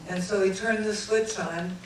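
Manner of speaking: usual speaking voice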